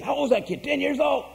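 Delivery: high-pitched imitation of amazed disbelief; retold amazement. Guests see little Ricky on tape, imitated amazement. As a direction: voice climbing as in astonishment, acted out. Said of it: "they were amazed".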